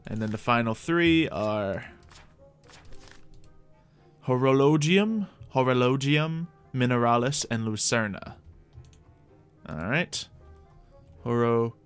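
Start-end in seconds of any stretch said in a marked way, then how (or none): none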